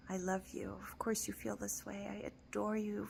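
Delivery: unvoiced tender whisper; soft loving whisper to yourself. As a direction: whisper it close like comforting someone. Soothing her own sad self.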